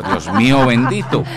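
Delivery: laughing exclamation. Retold or imitated. Shocked laughing reply.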